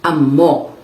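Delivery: "amo" stressed on the final "mo"; second-syllable stress. Shown as a demonstration. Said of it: "stressed on the second syllable".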